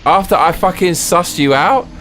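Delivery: outraged. Real, not acted. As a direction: yell at the game in disbelief. Outraged at the game killing him.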